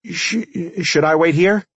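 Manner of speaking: high-pitched, stammering timid police chief; nervous stutter. A narrator's reading. Police chief asking whether to wait outside, stuttering nervously. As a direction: stumble on the first word, then ask timidly up high.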